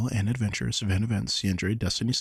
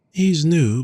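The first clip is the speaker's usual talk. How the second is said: pitched up, eager; excited; 9 semitones higher, 6 dB louder than usual. Acted. Hostess selling the Dragon Shifter, eager lift. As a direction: brighten up about the new one.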